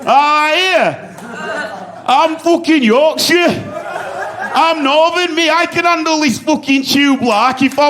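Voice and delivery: high, loud, slow Northern bravado; proud Yorkshire shout. Retold or imitated. Comic on the tube, shouting his Yorkshire pride to himself. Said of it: "I sat up like that".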